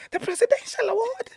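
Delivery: very high, crying voice, imitating the friend; tearful excitement. Friend announcing the award, crying with joy. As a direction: squeak the news out through happy tears. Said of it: "she's crying".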